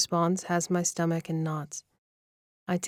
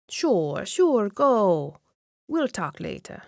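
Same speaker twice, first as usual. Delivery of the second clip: put-on higher, slower cooing older lady; doting grandma voice; 3 semitones higher, 4 dB louder, slower than usual. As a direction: coo at the couple like a proud nana. Nona waves him off.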